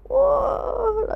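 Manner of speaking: high crying wah; a touch of a wail; mock wail. Voicing sad vlogger.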